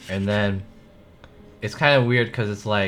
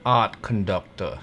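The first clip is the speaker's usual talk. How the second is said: English accent that drifts Southern; attempted English accent. Shown as a demonstration. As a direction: try an English accent, landing near American South. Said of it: "more of, like, a southern accent".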